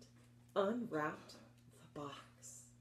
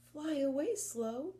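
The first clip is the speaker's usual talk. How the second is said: slow; 8 semitones higher than usual. Shown as a demonstration. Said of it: "the other named Slow".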